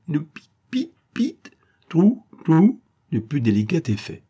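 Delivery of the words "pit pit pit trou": high whispered squeaks through a gag; breathy high chirps from a stifled mouth; gagged squeaks. A narrator's reading. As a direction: squeak like a gagged man trying to speak. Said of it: "ponctuer le discours de fouinard de Kirikiou".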